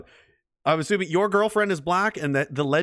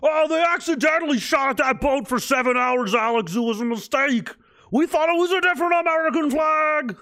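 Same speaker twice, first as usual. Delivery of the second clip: high, loud sarcastic mock excuse voice, shouted in places; 10 semitones higher, 3 dB louder than usual. Mocking the excuse for the Liberty attack in a high, loud mock voice. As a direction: whine out a fake excuse, pitched way up. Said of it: "What are they gonna say?".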